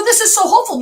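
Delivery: high, loud burst of hope; amazed delight. Retold or imitated. Reenacting her delight at the new church, high and excited. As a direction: gush it high, replaying the excitement.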